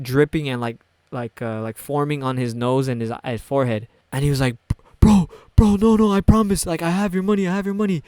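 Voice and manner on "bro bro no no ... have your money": high, loud imitation of a panicked man; panicked pleading. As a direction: act out desperate promises, voice jumping up. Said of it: "He started panicking".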